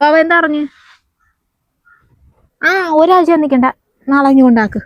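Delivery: high, loud and agitated, calling down the phone; upset mother-in-law shouting down the phone about the baby; alarmed shouting on phone. Acted. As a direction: shout your worry into the receiver.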